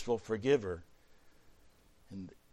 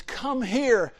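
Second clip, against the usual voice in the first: high, louder eager beckoning; heartfelt call; 11 semitones higher, 9 dB louder than usual. Acted. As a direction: call them into an embrace. Joseph calls his brothers to embrace.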